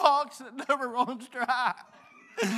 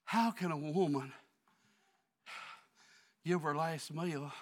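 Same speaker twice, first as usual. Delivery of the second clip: quiet musing; 9 semitones lower, 7 dB quieter than usual. Acted. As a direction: drop to a near hush and ponder aloud. Quiet reflective musing after preaching.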